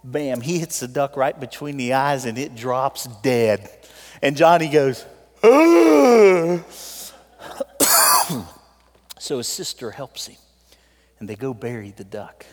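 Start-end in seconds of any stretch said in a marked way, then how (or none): 5.4-6.6 loud high "ugh" of dismay; sudden yelped groan. Retold or imitated. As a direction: groan like a boy who just shot the duck. Boy reacts to dead duck.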